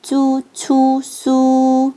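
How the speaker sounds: 쭈, 추, 수 each held on a clear "oo"; plain "u" vowel. Shown as a demonstration. Teacher shows learners the u after z, c, s, 쭈, 추, 수 with a clear "oo". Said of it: "우 소리 그대로 내실 수".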